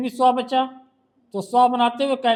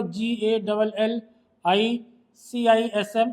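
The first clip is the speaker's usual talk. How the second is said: slow spelling; slower than usual. Shown as a demonstration. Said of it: "spells gallicism letter by letter".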